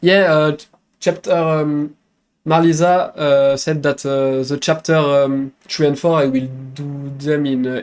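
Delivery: hesitant English with a French accent; French-accented English, searching for words between uhs. Real, not acted. Streamer explaining the missing French patch, French accent. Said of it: "Le accent is cute".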